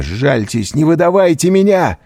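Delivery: мольба, нарастающая до высокого крика; умоляй, повышая голос к концу. A narrator's reading. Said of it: "взмолился Андреа". (отчаянно умоляя)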